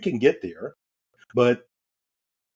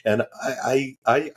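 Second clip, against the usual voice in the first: ordinary delivery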